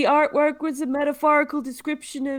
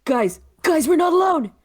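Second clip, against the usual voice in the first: alarmed call; 4 semitones higher, 6 dB louder than usual. Acted. Alarm calling to the group.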